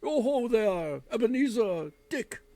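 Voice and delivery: put-on a merry old master calling his apprentices; jovial call. Fezziwig calling out to his apprentices, loud and jovial. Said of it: "called out in a jovial voice".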